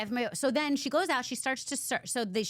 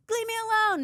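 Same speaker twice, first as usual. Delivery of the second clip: much higher, louder snapped line; heroine snapping; 14 semitones higher, 5 dB louder than usual. Retold or imitated. Retelling the heroine storming off, a high snapped line. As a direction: snap it high as you storm out.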